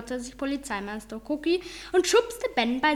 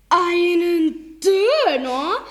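high, slow, stunned "Einen Döner?"; baffled policeman repeating the word; flabbergasted; 5 semitones higher, 7 dB louder, much slower than usual. Acted. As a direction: drag it out in disbelief. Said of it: "wiederholte P M Cookie, der so verdattert".

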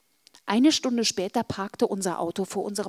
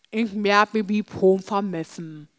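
talking through a mouthful; words mumbled round food; mouth full. Acted. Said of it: "Mit vollem Mund sagte ich".

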